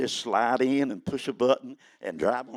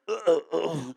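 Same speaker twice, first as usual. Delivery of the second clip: strained groans; 3 semitones higher than usual. Retold or imitated. Bending over to pick up quarter.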